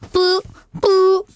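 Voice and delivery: sustained mock phone beep; imitated phone tone. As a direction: mimic the beep after hanging up. Imitates phone tone as call ends.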